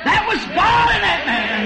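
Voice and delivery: joyful shouted sermon peak; preaching at full force. Acted. Preacher crying out to the church that God was in the man. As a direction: lift it up with a laughing roar.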